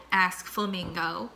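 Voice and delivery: ordinary delivery, nothing marked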